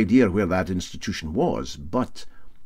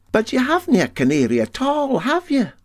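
much higher, doubting question; Dougie's voice; 4 dB louder than usual. Acted. Mate Dougie to captain, high and doubting. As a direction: catch the captain out.

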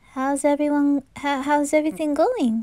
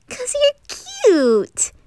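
flirty tease; 12 semitones higher, 4 dB louder, much slower than usual. Acted.

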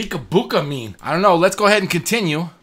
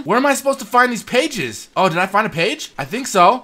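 ordinary talk

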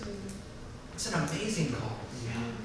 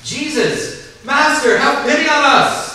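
lepers crying out, higher and louder; loud plea; 9 semitones higher, 8 dB louder, slower than usual. Acted. Lepers calling to Jesus from afar, loud pleading cry. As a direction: call across the distance, begging for pity. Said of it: "called out in a loud voice".

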